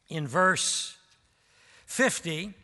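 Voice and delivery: normal talking voice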